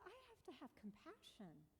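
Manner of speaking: soft, high reassuring voice; speaking kindly to herself after the mistake; self-compassion. Retold or imitated. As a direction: gently talk yourself down. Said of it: "I said".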